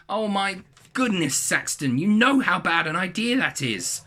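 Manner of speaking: high, slow, drawn-out dismay; exasperated mum. A narrator's reading. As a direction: despair at them like a long-suffering mum. Mum despairs, palm in face.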